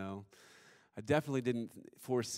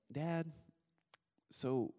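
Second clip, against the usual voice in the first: higher, lightly voiced small child with pauses; thoughtful little daughter; 5 semitones higher, much slower than usual. Two-year-old daughter questioning her blind dad, lightly imitated, higher and hesitant. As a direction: mimic a toddler piecing it together. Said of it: "she's really thoughtful and reflective".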